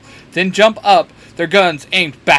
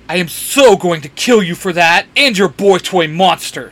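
raised, threatening voice; angry yell; 3 semitones higher, 6 dB louder than usual. A narrator's reading. Sam threatens the warlock who threw Dean, yelling. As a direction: yell the threat with some force. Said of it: "Sam yells".

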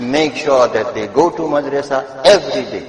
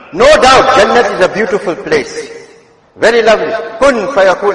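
raised, emphatic sermon voice; fervent preacher, surging on key phrases; impassioned preaching; 6 semitones higher, 6 dB louder than usual. Real, not acted. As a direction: preach it out with high, loud openings. Preaches at full force.

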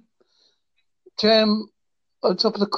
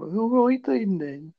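put-on lightly done Brummie accent; easygoing Birmingham accent impression; 4 semitones higher than usual. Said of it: "I can do a Birmingham".

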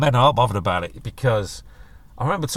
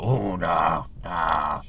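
mock reluctance; 8 semitones lower, 5 dB quieter, much slower than usual. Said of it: "all men are like".

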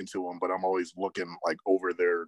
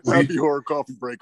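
laughing burst, fast and louder; 7 semitones higher, 7 dB louder, faster than usual. Real, not acted. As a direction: blurt it out through laughter. Laughing correction.

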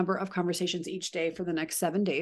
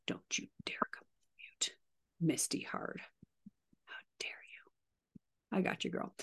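hushed teasing whisper; half-joking whisper at a friend who came off mute; playful whisper; 3 semitones lower, 13 dB quieter than usual. Real, not acted. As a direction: whisper it like a mock scolding. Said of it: "teasing a colleague off mute".

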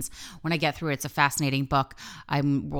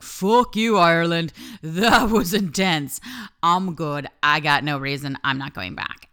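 defiant retort; 5 dB louder than usual. Retold or imitated. Defiantly telling off Ireland.

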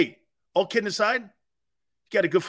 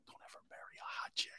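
fast, voiceless, much softer whisper; whispered warning to single guys; whispered aside; 20 dB quieter, faster than usual. Real, not acted. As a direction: hiss it fast like a warning in secret. Secret advice aside to listeners.

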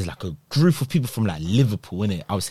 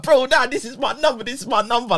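much higher pleading through laughter; laughing protest; 13 semitones higher than usual. Retold or imitated. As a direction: insist on it while cracking up. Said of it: "I'm laughing 'cause I'm still".

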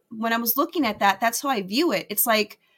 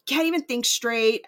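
ordinary delivery

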